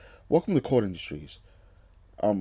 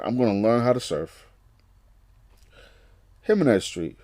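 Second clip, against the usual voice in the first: normal talking voice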